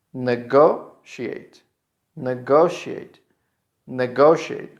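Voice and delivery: "Negotiate" as ne-GO-she-ate; slow syllable-by-syllable model. Shown as a demonstration. Teacher drills the verb for learners, slow ne-GO-she-ate. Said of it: "the final part of this verb is pronounced like".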